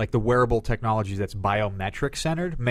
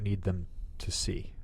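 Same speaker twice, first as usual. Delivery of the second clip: whispered, softer; whispered aside; 3 semitones lower, 11 dB quieter, slower than usual. Real, not acted. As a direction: drop to a whisper. Unvoiced aside.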